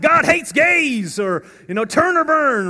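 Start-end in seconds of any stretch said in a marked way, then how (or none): 0.0-1.2 loud, high, slow mock yell of "God hates gays"; hammed-up condemning street preacher yelling. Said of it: "they're yelling things like". 1.9-2.7 loud, high mock yell of "Turn or burn"; street preacher yell. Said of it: "they're yelling things like".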